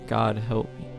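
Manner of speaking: normal talking voice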